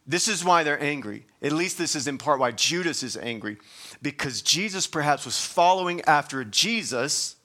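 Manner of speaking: usual speaking voice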